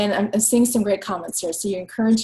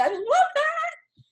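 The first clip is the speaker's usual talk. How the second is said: much higher, slow delighted squeal; gushing; much slower than usual. Real, not acted. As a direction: stretch "I love that" way up high and slow. Repeats comment, then gushes it.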